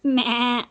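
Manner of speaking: a light mock bleat "మ్యా" like a goat. Shown as a demonstration. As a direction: bleat "మ్యా" gently, showing the child the goat. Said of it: "ఎట్లా అంటుంది goat?". (మేక లాగా మెల్లగా "మ్యా" అని అరవడం)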